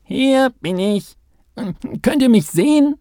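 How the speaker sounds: high, loud eager mole call; excited high call from the molehill. A narrator's reading. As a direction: shout across the meadow, waving wildly. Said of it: "winkte er stürmisch und rief".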